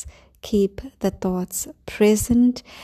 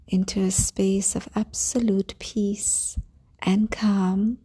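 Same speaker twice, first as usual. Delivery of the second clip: calm grounding exercise. Acted. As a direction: guide them gently into calm. Leads a grounding meditation.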